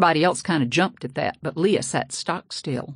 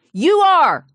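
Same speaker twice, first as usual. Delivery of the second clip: much higher, much louder scream; 12 semitones higher, 8 dB louder than usual. A narrator's reading. Daughter screams at father who pretends not to hear. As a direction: hurl the answer back at him. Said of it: "she screamed at him".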